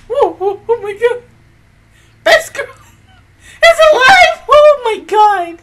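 slow high laugh-crying shout; sob-laugh with joy; hysterical laugh. Real, not acted. Overjoyed reaction, laugh and cry.

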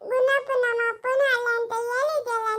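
put-on a small girl refusing, sulking back at her elder; little Janaki refusing to go to her mother's house, defiant; stubborn little girl. Child character answers back.

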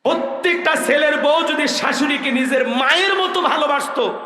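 high, ringing preacher's call; fervent sermon cry; preaching shout. Real, not acted. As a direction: throw it to the back of the crowd. Preacher opening with raised voice.

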